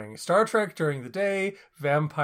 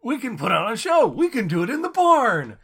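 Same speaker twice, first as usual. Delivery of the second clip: hammed-up, higher and louder, with swooping pitch; mock gung-ho enthusiasm; 5 semitones higher, 5 dB louder than usual. Co-host mocking the convention plan, hammed-up enthusiasm. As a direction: parody an eager let's-put-on-a-show pitch. Mock eager let's-put-on-a-show kid.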